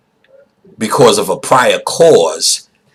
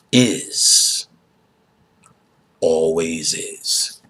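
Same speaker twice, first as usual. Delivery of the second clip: mock wise elder; 4 semitones lower, 6 dB quieter, slower than usual. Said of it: "he said, you know".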